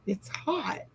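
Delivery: breathy whisper, voice gone; whispering hummingbirds. Retold or imitated. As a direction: whisper it like a wilting little bird. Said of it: "they're like".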